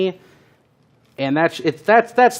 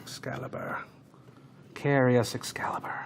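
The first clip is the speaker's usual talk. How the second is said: whispering; 11 dB quieter than usual. Pretend. Whispering to the screwdriver.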